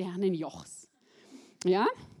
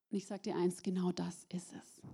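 whisper; 5 semitones lower, 11 dB quieter than usual. Acted. Confiding point to the congregation.

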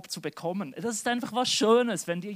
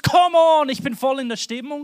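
loud, high burst of enthusiasm; fired-up worshipper; 6 semitones higher, 13 dB louder than usual. Acted. One churchgoer hearing the call to praise, fired up. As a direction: shout it, pumped up and rushing on. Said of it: "Und der eine denkt".

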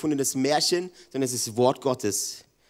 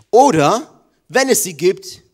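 sudden loud outburst; 6 semitones higher, 10 dB louder, slower than usual. Acted. Preacher voicing the devil's lie.

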